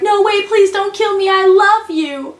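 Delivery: high-pitched, hammed-up begging; mock pleading. Captive begging his captor for life, mock desperate. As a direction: plead high, dragging out the last words. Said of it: "And then Yoon Bum's like".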